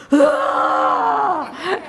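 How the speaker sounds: loud, high imitated cry; startling wordless yell. Storyteller imitating Eddie rising from bed, startling yell. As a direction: burst out with a long raw "ah". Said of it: "and he went".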